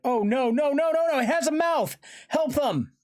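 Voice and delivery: very high, loud panic; horrified cry. A narrator's reading. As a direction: scream in horror and plead for help. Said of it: "Tobias cried".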